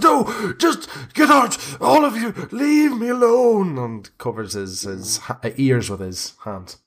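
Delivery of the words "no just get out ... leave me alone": high, crying, begging to be left alone; terrified plea. Acted. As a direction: cower under the bench, sobbing. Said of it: "he just goes".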